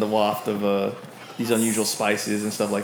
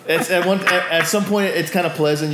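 laughing; 7 semitones higher, 4 dB louder than usual. Real, not acted. Still laughing while narrating scene.

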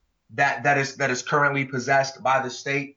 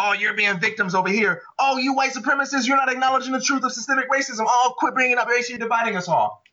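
mock bickering voices; 6 semitones higher than usual. Imitating both sides arguing.

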